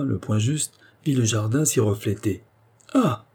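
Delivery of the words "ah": high-pitched cry of discovery; excited exclamation. A narrator's reading. Old godfather to goddaughter on spotting the flower, exclaiming. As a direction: cry out "Ah!" high, in sudden delight. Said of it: "s'écria-t-il".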